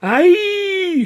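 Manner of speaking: high, loud melodramatic "Aye!" shriek; theatrical high scream of shock; hammed-up scream. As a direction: shriek it like an opera diva hearing bad news. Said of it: "she screamed".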